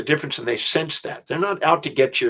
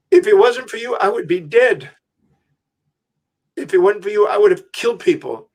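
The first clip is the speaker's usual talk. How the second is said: high tearful voice of a weeping prisoner; imitating a grown man crying with gratitude; retold crying; 6 semitones higher than usual. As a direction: pitch it up as a man overcome with tears. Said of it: "And he's crying".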